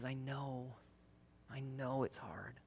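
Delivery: soft, slow comforting murmur; tender reassurance. Retold or imitated. Midwife softly comforts the exhausted mother in labor. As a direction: hush her gently like a midwife.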